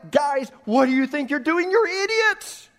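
staged angry outburst at the top of the voice; yelling. Pretend. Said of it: "And I yell".

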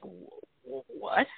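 breathy, cut-off shocked what; stunned disbelief. Retold or imitated. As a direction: gasp out the question in shock. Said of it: "my brother and I are like".

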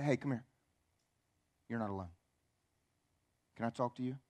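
low, soft acted invitation; gentle reassurance. Believer to somebody who is struggling, soft and reassuring. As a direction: draw them aside quietly and kindly.

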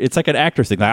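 normal talking voice

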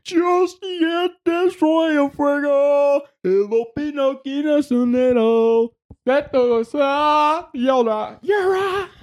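high, drawn-out fan chanting; high-pitched mock crowd cries; imitated fans. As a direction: mimic the fans behind you, stretching each word high. Imitating people behind at game.

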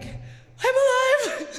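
high, slow, laughing shout of relief; ecstatic yell. Retold or imitated. After the crash, she laughs out a loud high yell. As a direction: shout it out through a laugh, thrilled to survive. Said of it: "And I'm like".

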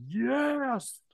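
a high, loud cheer of triumph; cheering yes. Retold or imitated. Retelling his cheer in the theater, a loud high yell. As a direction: leap up and cheer the moment. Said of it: "stood up and was like".

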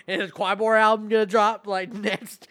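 laughing, retold fan excitement; laughing disbelief. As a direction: ask it through excited laughs.

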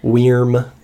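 "Weirm" said loud as "weerm". Shown as a demonstration. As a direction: say "Weirm" back with confidence.

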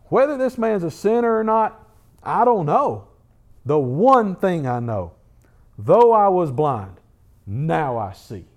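measured, emphatic declaration, pausing between phrases; defiant testimony. Acted. Healed blind man answering his questioners, firm testimony.

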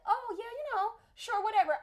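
high, airy "sure, whatever", shrugging it off; mock breezy brush-off. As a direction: play it off lightly, like you don't care. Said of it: "And I'm like".

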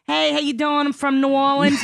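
a hint of a brash New Orleans local; mock New Orleans accent. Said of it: "they really sound like".

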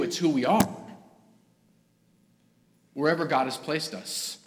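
ordinary delivery

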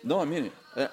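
ordinary talk, nothing marked

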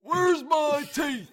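put-on high, slow, shouting old man. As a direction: croak it like a toothless old man. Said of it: "Your old voice sounds weird".

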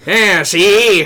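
tough-guy gangster voice; noir detective voice. Pretend. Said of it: "my noir detective voice".

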